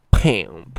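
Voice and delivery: PIMP blurted loud and drawn out; mock concession. Host gives in to co-host's word, PIMP exaggerated loudly. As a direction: overdo "pimp" with a big loud burst.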